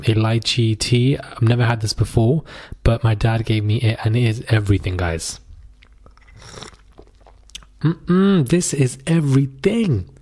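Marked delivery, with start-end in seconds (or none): none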